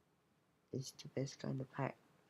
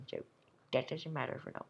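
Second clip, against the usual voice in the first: ordinary talk, nothing marked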